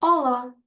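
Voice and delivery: European Portuguese "Olá", said as a clear model; Portuguese pronunciation. Shown as a demonstration. Teacher showing learners how to say hi, European Portuguese pronunciation. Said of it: "So we say".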